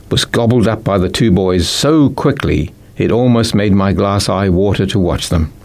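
ordinary delivery, nothing marked

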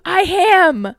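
AM shouted high as "ham"; loudly mock "am" mangled into "ham"; garbled word blend. Podcaster echoing co-host's imitation, AM yelled as "ham". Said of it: "a couple of garbled".

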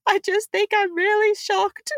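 retold teary voice, lightly hammed; mock teary shock. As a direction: squeak it out as if near tears. Retelling her tearful reply.

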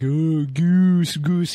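mock goblin drooling over a goose. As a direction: slurp the word goose like a hungry dumb goblin. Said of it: "He's drooling".

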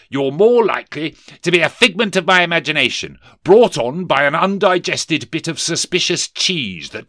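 high, loud, indignant reasoning; disbelieving Scrooge. Acted. Scrooge tells the ghost it is imagined, loud and high.

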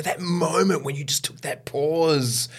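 lightly voiced awed colleague; impressed castmate. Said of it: "And they go".

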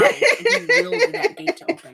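high, giggly insistence; high-pitched, cracking up at own theory; laughing. Real, not acted. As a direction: squeal it out through laughter. Joking about Manson's mind powers.

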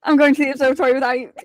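fast, loud, laughing mock storm-off; playful mock exit line through a laugh; laughing mock huff. Character storms off in mock anger.

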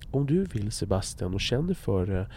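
hushed parody of an over-careful host; mock careful whisper. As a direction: murmur as if he might startle. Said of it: "det är okej att ni är försiktiga".

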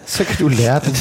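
quoted line repeated through a laugh. Shown as a demonstration. Father repeating daughter's cheeky line, laughing. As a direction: relish it while laughing. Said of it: "repeats line laughing".

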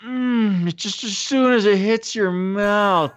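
high, hammed-up voice savoring candy, slowing down at the end; mock savoring. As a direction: gush over the candy like a goof. Voicing Franco savoring candy.

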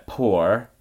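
"poor" meaning pour, as POH-r; Scottish pronunciation. Shown as a demonstration. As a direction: say "poor" with oh and a sounded R. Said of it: "I'm pouring a glass of wine".

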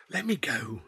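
pleading whisper. Acted. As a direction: beg to be released, under your breath. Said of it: "she said".

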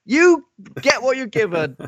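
high-pitched scolding parent. Pretend.

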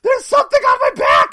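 very high, loud hammed-up shriek; mock panicked scream. Host acting Rincewind with something on his back, hammed-up scream. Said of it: "I just love Rincewind screaming".

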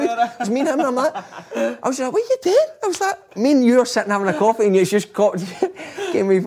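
high-pitched talk through laughter; can barely get it out for laughing; laughing while telling. Real, not acted. Laughing while retelling prank.

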